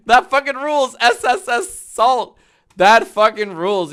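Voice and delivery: loud, hyped and delighted; excited. Real, not acted. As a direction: shout your delight at the name. Hyped over funny username.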